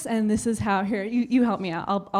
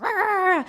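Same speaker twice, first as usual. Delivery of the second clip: high mock attack growl; imitated monkey roar; 8 semitones higher than usual. Monkey sister leaping onto the bed, high mock roar. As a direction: let out a high, drawn-out play roar.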